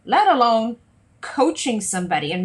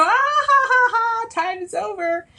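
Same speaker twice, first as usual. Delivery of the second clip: very high, loud fake ha-ha; hammed-up sing-out laugh cutting someone off; mock dismissive laugh; 14 semitones higher, 4 dB louder than usual. Uncaring coach ending a tearful client's session, mock laughing. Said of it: "I'm not gonna go".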